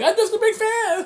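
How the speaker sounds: very high imitated plea; mock fangirl squeal. Gwenpool grabbed by Miles, squealing mock plea. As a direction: squeak it out like a hammed-up fan. Retold fan pleads after being grabbed.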